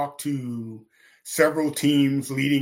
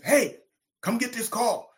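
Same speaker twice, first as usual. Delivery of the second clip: mock call to the phone, higher pitch; 10 semitones higher than usual. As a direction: imitate someone calling him over. Retold calling him to phone.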